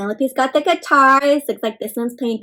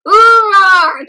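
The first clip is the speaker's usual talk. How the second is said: loud roar, pitched up, playful; lion roar; 6 semitones higher, 8 dB louder than usual. Pretend. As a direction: roar like a friendly lion for kids. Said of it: "Can you roar like a lion?".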